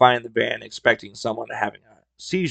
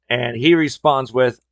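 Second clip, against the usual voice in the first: ordinary delivery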